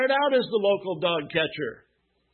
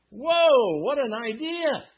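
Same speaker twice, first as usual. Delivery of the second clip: high, playfully astonished exclamation; mock amazement; 5 semitones higher, 3 dB louder, slower than usual. Preacher teasing the congregation about church, in mock amazement. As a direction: feign surprise at the obvious.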